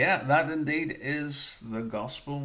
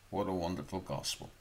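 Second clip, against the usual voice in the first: ordinary talk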